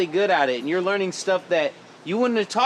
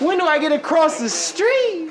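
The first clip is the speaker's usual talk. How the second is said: much higher, louder squeaky fan voice; mock eager fan; 11 semitones higher, 4 dB louder than usual. Host imitating fans asking about the street shot, high mock voice. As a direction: parrot the fans' question in a high put-on voice. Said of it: "the number one question".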